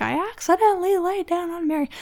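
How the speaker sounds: high-pitched whiny imitation of a crying kid; mock wailing child. Mom re-enacting her upset daughter's complaint, mock wailing. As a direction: wail it up high, without raising the volume. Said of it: "basically wailing, 'cause she's so upset".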